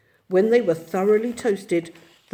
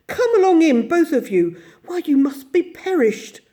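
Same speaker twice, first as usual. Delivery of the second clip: louder, higher hearty welcome; warm welcome; 9 semitones higher, 6 dB louder than usual. Acted. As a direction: welcome them in kindly. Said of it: "in quite a different voice".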